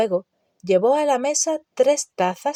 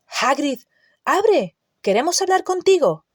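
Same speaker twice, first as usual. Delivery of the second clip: high, raised call through a door; 7 semitones higher than usual. A narrator's reading. Harry knocking on Hagrid's door, calling out.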